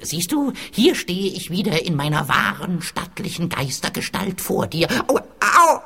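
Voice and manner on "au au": loud, high yelps of pain; cry of pain. Acted. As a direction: yelp as if it hurts. Ghost in pain.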